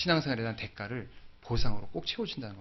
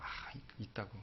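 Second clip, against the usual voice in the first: hushed admission; 5 semitones lower, 20 dB quieter, slower than usual. Retold or imitated. Hesitant confession to wife.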